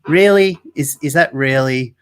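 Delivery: questioning it as an imagined appalled onlooker; incredulous. Retold or imitated. Reacting to the shooting of rescue dogs, incredulous.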